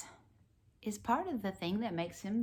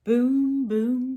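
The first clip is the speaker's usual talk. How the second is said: thunder boom; 5 semitones higher, 9 dB louder than usual. Pretend. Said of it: "makes thunder".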